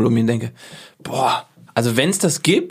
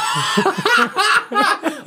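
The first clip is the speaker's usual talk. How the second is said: high burst of laughter. Real, not acted. Laughs at being caught.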